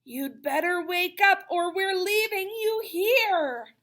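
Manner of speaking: big sister waking the dreamer, loud and slow; sister in my ear. Acted. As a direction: rouse the dreamer, slow and insistent. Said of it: "spoke in my ear".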